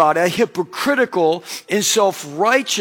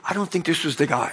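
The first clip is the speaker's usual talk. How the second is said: low, hushed, quick aside; softly murmured retold aside; confidential aside; 4 semitones lower, 6 dB quieter, faster than usual. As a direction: imitate a discreet word in someone's ear. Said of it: "the usher leans over to me".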